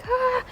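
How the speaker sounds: relieved high sigh. Retold or imitated. Cockroach finally dies.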